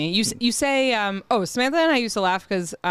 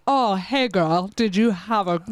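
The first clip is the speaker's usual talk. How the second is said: imitating weird waiter voice; slower than usual. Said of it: "like, weird voice?".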